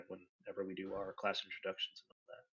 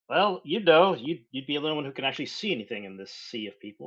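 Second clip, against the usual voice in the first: much louder and higher, with swoops in pitch; loud in-character quip; 6 semitones higher, 13 dB louder than usual. Acted. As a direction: call it up loudly to a taller friend. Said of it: "Kadark is like".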